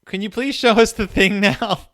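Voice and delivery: put-on high, loud childish begging voice; mock kids pleading. Kids begging the strange girl, high whiny plea. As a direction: whine it like impatient little kids. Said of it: "So they're like".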